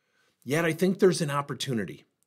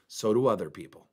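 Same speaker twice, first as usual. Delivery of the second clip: ordinary talk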